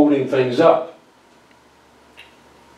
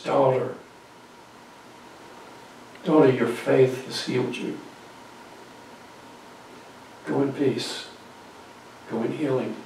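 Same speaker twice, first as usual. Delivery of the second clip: intimate; 10 dB quieter than usual. Acted. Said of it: "with great intimacy".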